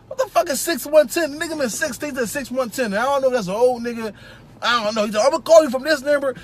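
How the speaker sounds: a hint of a chatty hiring manager laying out the rules; lightly voiced interviewer. Interviewer briefing a new technician, lightly voiced and chatty. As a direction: play the boss rambling through the shift times.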